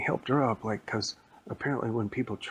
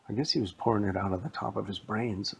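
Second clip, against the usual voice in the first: ordinary delivery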